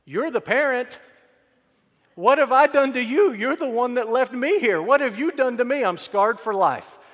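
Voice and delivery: put-on high, loud outburst of a wronged kid; hammed-up accusing tantrum; disrespectful child. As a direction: yell back at your parents, pitch way up. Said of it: "What would a disrespectful child have said?".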